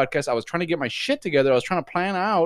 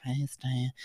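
flat monotone greeting; 9 dB quieter than usual. Retold or imitated. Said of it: "you're like".

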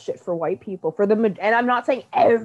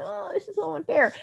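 higher, softer whiny complaint; mocking whiners, a pouty high whimper; mock whining; 10 semitones higher, 5 dB quieter than usual. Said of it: "we all fucking complain".

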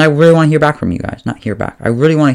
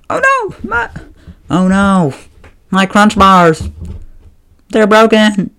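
panicking sister; 9 semitones higher, slower than usual. Retold or imitated. Sister wailing to family over broken Crunch bars, mock panic. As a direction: squeal it like a kid whose treat is ruined.

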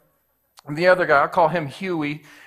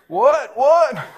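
mock tough what; 7 semitones higher, 9 dB louder than usual. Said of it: "you're like".

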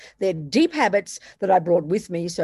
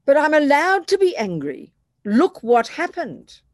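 indignant protest; 6 semitones higher, 5 dB louder than usual. Retold or imitated. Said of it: "we would say".

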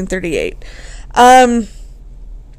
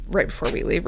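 soft whispered aside, suggestive; 4 semitones lower, 8 dB quieter, faster than usual. Shown as a demonstration. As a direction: breathe it out, teasing.

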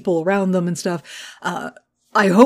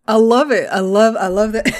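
ordinary talk, nothing marked

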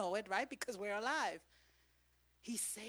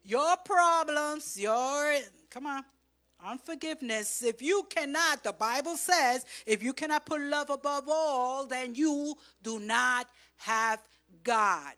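loud, high climax; full-force preaching; 6 semitones higher, 14 dB louder than usual. Acted. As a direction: drive it home to the congregation.

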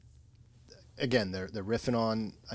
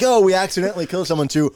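fast mock exclamation; 7 semitones higher, 11 dB louder, faster than usual. Mock confession voiced fast.

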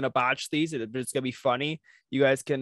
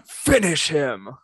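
slow, louder, botched announcer call; bad Mortal Kombat announcer; 6 dB louder, much slower than usual. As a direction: drag it out, half-hearted. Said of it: "That was not a good one".

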